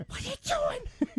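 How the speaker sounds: strained high yell under the breath; whisper yell. Retold or imitated. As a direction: yell at them without raising the volume. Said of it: "I had to whisper yell it".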